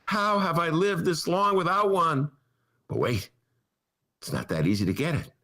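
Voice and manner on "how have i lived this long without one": high, gushing mock amazement; sarcastic starstruck fan; hammed-up delight, voice raised high; mock awe. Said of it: "And I'm thinking".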